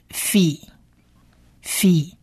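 "fi" said slowly as f plus short i; "fi" as a drawn-out model syllable. Shown as a demonstration. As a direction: sound out "fi" for learners, pausing between takes. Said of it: "我们是短母音的一的音".

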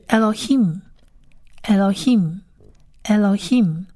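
"Elohim" slowly as eh-lo-heem; model pronunciation. Shown as a demonstration. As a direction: repeat "Elohim" with long gaps between. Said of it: "第三个字是".

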